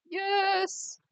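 high sudden yelp of joy; excited cheer. Real, not acted. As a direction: burst out with a high yes. Cheers at the screen.

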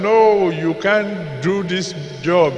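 ordinary talk

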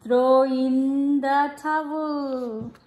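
gentle storybook reading, easy pace; reading to children. Acted. Mother reading a bath-time picture book to her child, gentle storybook voice. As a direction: read it aloud to a little one.

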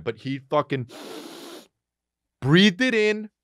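ordinary talk

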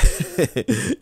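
a sudden high, loud burst of a laugh; scornful laugh. Real, not acted. Laughing at the flattery his own people hear, high burst.

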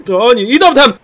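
loud, high warning; startled alarm. Real, not acted. Gamer warning team of enemies coming, alarmed. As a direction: cry out as they appear.

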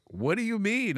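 high, puzzled what do you mean; friend's bewildered question; confused question. Retold or imitated. Friend confused, asking back.

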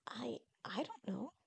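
slow, whispery, halting answer; hesitant admission. Acted. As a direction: stammer softly, at a loss. Unsure character answers softly.

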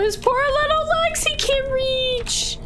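mock pity. Pitying the small character.

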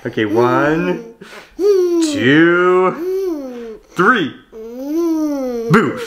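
high sing-song countdown to boo; playful peekaboo. Real, not acted. Peekaboo game with toddler.

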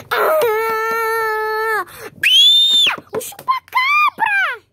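high, loud frightened scream at the monster; startled scream; scared shriek. Acted. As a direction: shriek in terror at the chupa-cabra. Pony scare, chupa-cabra appears.

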